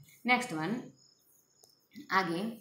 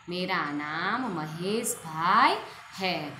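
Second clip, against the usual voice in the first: very slow, spelled-out dictation; slow correct example; 3 dB louder, much slower than usual. Shown as a demonstration. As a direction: dictate each word slowly for students. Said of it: "तो ये वाक्य सही है".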